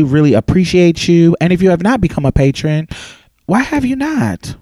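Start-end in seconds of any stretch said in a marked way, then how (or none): 3.5-4.6 playful scolding. Real, not acted. Teasing listeners to donate.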